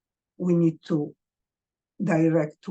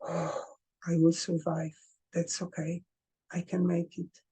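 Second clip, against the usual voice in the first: much softer, hugging yourself; quiet self-comfort; 5 dB quieter than usual. Shown as a demonstration. Hugging oneself after a hard day, soft reassurance. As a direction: murmur reassurance while holding yourself. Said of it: "Hug yourself hard and say".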